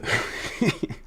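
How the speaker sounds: soft breathy giggle. Real, not acted. Streamer amused by a silly question, soft giggle. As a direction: giggle under your breath at yourself.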